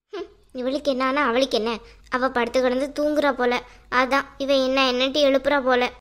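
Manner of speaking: low-pitched, grumbling inner monologue; irritated woman. Acted. Irritated character's private grumbling.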